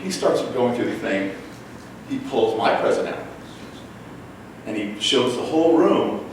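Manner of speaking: ordinary talk, nothing marked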